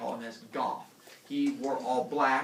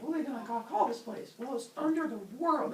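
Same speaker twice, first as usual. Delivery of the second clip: mock gods debating; 11 semitones higher than usual.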